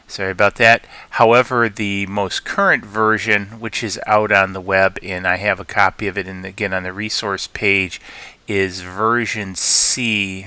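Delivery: ordinary talk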